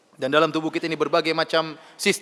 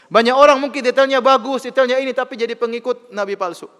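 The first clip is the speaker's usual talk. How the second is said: much higher and louder, driving the point; preaching at full force; 9 semitones higher, 8 dB louder than usual. Acted. Preacher warning the congregation about false prophets, loud preaching. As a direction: thunder it from the pulpit.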